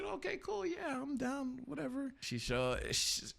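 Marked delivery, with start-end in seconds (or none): 0.0-2.1 much higher, light and breezy, as if shrugging it off; breezy agreement. Retold or imitated. Agreeing to the best friend's setup with a coworker, high and breezy. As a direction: toss off a high, careless yes.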